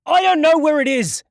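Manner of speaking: full desperate high scream. A narrator's reading. Beaten captive screams his denial at the thugs, desperate high scream. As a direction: shriek it out in panic. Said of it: "he screamed".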